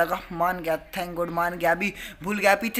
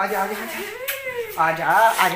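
very slow, high, drawn-out aajaa; coaxing sing-song; 6 semitones higher, much slower than usual. Real, not acted. Brother luring sulking Prateek over, slow high sing-song coax.

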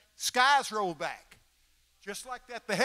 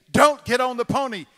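warning voice; 7 semitones higher, 14 dB louder than usual. Retold or imitated. Voice repeats its warning.

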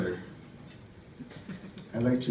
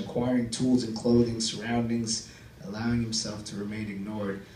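measured, subdued prose reading; story reading; 6 dB quieter than usual. Acted. Comic reading his fiction to the crowd, subdued narration. Reads own prose before the set.

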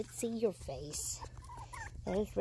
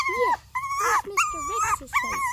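very high, louder cooing; 10 dB louder than usual. Real, not acted. Owner cooing over the puppy's face, very high and loud. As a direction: squeal it to the puppy.

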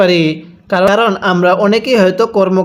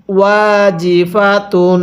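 slow, held syllables; measured teaching recitation; model recitation; slower than usual. Shown as a demonstration. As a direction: draw out each vowel for the class. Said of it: "এরকমভাবে শিখব".

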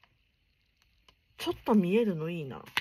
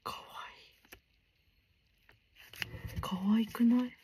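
excited hushed whisper; late-night whispered delight; 3 semitones higher than usual. Real, not acted. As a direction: gush in a whisper so nobody wakes. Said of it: "あんまり大きい声で叫べれないのがね".

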